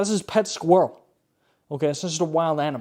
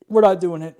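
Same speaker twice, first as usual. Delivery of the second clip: firm and louder, final word; refusing to cover the pizza box, louder and firm; louder insistence; 5 semitones higher, 8 dB louder than usual. Real, not acted. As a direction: put your foot down.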